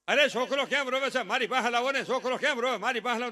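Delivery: hammed-up eager old man calling; mock grandfather. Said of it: "grandfather". (દાદાની નકલ, મોટેથી અને ઉતાવળે)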